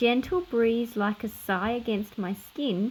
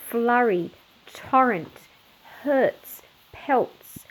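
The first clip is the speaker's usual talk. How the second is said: ordinary delivery, nothing marked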